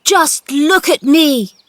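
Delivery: high, loud, slow call; triumphant shout. A narrator's reading. Boy standing, shouting up at the gardener, triumphant. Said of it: "he shouted up at Ben".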